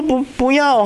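put-on high-pitched child's refusal; frightened little boy. Small son refusing to play, mock scared child voice. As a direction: imitate a scared kid balking. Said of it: "他那个小朋友很小嘛".

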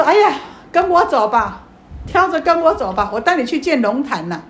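a hint of a gleeful old woman calling him along; lightly voiced old woman. Old woman mocks and leads monk off. (略带老婆婆腔调，高声调侃)

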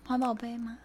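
very soft timid question; barely audible. Retold or imitated. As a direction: ask it almost silently. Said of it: "你学一下". (怯生生的小声提问)

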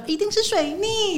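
high, slow, awkward acted line; forced high line reading. (硬挤出来的台词)

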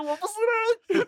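high, slow, sobbing self-blame with laughter; hammed-up sob. At the apology, sobbing in hammed-up remorse. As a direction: whimper it in mock despair. Retold self-reproach, crying.

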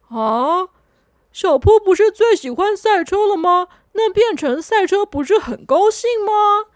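a helper who doesn't see the problem; cheerful friend, lightly puzzled. Acted. 红不让 speaks.

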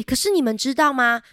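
plain reading voice

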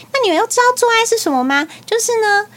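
high, lightly voiced mom talking to a child; imitated explaining mother. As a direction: mimic a mother starting a lesson.

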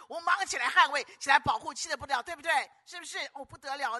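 rally the church to rise up; fervent pulpit call; rousing preaching. Acted.

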